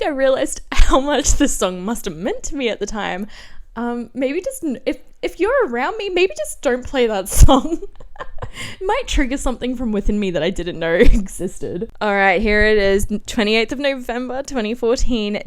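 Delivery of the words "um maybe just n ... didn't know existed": ordinary talk, nothing marked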